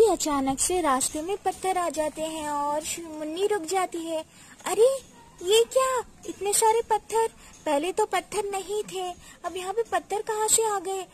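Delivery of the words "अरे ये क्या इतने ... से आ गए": high-pitched acted astonishment; taken aback and puzzled, voice raised high; startled surprise. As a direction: react with a startled jump in pitch. Girl finds rocks blocking road.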